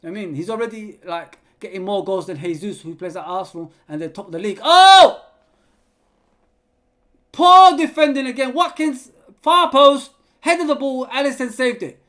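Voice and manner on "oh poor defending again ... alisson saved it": much higher, much louder live yell at the chance; excited shout. Real, not acted. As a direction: scream it as the header goes in. Live reaction to a near goal.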